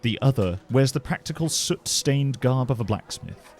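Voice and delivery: ordinary talk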